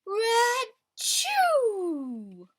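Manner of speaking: loud, high mock sneeze; cartoon sneeze for the kids; big sneeze. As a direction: burst out a hammed-up achoo. Bear sneezes in story.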